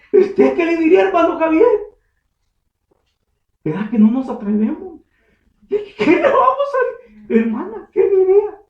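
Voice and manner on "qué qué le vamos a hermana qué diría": much higher, laughing ask; laughing question. Real, not acted. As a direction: ask them while laughing.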